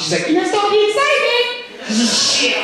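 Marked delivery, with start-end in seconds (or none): 0.3-1.7 very high squealed voice; high, giddy caricature; mock excited girl. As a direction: imitate her in a high squeal. Imitates girl who crashed car.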